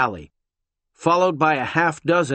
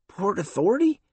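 confused; 8 semitones higher than usual. Acted.

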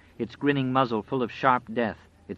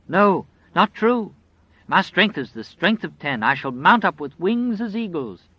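defiant cry; 8 semitones higher, 6 dB louder than usual. Acted. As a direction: fight back with your faith. Old woman defies the wolf.

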